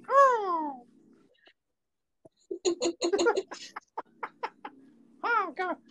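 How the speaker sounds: very high cartoon mouse voice with giggles; Mickey Mouse voice. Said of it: "successful Mickey Mouse impression".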